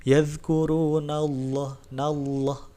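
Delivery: Yadhkurunallah, slow, full-mouthed lam; thick lam recitation; heavy lam. Shown as a demonstration. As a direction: chant nallah with a thick lam. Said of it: "seperti penuh di dalam mulut".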